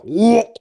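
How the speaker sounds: high rising retching whoa; a heave that slips out as whoa; mock retch whoa. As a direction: gag as you say it. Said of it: "I go".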